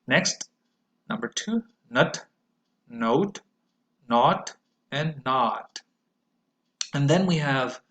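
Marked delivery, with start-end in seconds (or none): 1.9-5.8 nut, note, not, not with the mouth opening wider; slow on the last not; vowel series demo. Shown as a demonstration. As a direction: model each vowel. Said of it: "my mouth opens wider".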